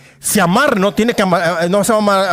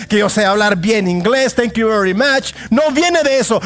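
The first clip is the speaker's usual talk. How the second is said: full-force preaching; 6 semitones higher than usual. Acted. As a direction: hammer each no viene home.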